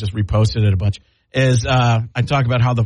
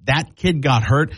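usual speaking voice

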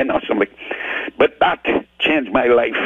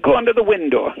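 lady's instruction; 10 semitones higher, faster than usual. Retold or imitated.

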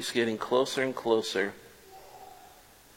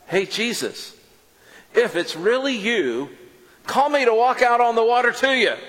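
calling out; 10 semitones higher, 5 dB louder than usual. Retold or imitated. Peter calling out to Jesus.

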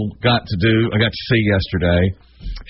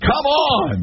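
mock indignant.

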